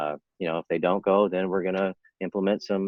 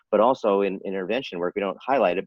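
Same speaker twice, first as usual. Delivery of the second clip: usual speaking voice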